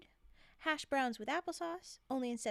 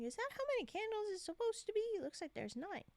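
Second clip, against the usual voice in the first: puzzled self; 8 semitones higher, 3 dB quieter, faster than usual. Retold or imitated. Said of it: "I was just like".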